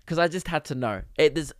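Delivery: ordinary delivery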